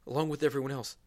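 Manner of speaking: ordinary delivery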